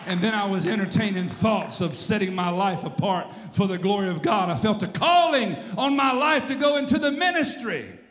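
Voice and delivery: high, loud revival preaching; preacher crying out to a congregation; full-force preaching. Real, not acted. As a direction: build to a soaring shout about the calling.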